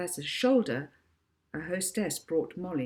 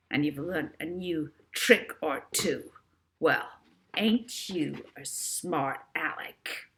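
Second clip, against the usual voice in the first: villain taunting absent Molly; menacing villain; slower than usual. A narrator's reading. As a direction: savour each threat. Villain monologue threatening Molly.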